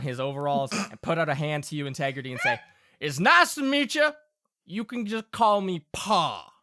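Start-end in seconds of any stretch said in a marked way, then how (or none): 3.0-6.5 higher, folksy Southern father; friendly Southern Pa. Acted. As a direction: play a welcoming country pa. Game master plays Pa.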